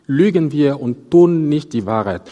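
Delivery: "tun" as the clear peak of the line; stress on tun. Shown as a demonstration. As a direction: lean on the word "tun" in "Lügen wir und tun nicht die Wahrheit". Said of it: "die Betonung hier liegt auf das Wort tun".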